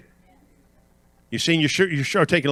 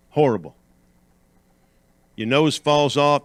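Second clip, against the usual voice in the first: usual speaking voice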